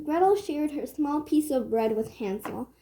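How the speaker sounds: ordinary delivery, nothing marked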